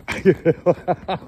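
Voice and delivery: high, loud burst of laughter. Real, not acted. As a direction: burst out laughing at the horse's antics. Laughs after horse antics.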